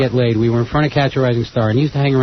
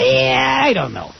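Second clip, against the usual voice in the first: a pitched-up squawked yeah, then dropping; lightly voiced shy comic; mock Gilbert hesitating; Gilbert Gottfried impression; 10 semitones higher, slower than usual. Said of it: "that's a great Gilbert Gottfried impression".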